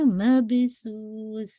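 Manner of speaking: مبسوس drawn out slowly; slow recitation demo. Shown as a demonstration. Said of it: "make sure".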